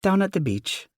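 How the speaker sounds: mother voice. Acted.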